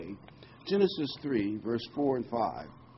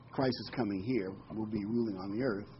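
ordinary talk

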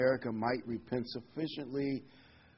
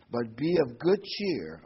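slow, louder, each word of "be of good cheer" weighted; emphasis on be of good cheer; 7 dB louder, slower than usual. Shown as a demonstration. Pastor urging the congregation to take heart, stressing the words. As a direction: lean hard into "be of good cheer". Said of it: "I want to emphasize the word be good cheer".

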